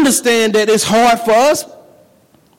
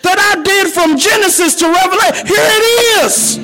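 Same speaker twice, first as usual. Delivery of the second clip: preaching; 8 semitones higher than usual. Acted. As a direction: preach it with rising fire. Preaching at full force.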